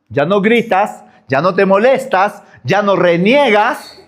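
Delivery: usual speaking voice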